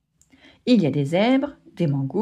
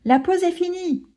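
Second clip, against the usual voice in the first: cub calling out; 9 semitones higher than usual. Acted. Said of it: "crie le lionceau".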